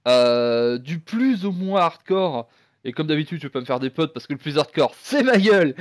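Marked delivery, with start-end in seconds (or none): 5.0-5.7 laughing at himself. Real, not acted. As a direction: say it through a laugh. Owning up to hardcore opinion.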